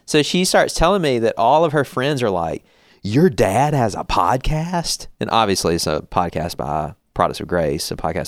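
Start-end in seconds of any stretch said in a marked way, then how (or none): 3.0-5.1 slow, drawn-out disbelief; higher, lightly mocking astonishment; mock amazement. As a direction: imitate an incredulous teenager, stretching it out. Voicing daughter's incredulous friends.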